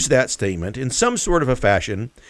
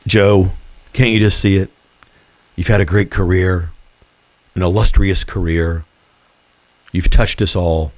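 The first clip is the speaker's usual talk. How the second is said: mock gentle farewell; 5 semitones lower, 4 dB louder than usual. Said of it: "gently remove him".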